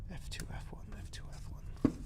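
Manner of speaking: whispered muttering of key names. Real, not acted. Streamer whispering hotkeys to himself while switching scenes. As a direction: whisper the keys to yourself.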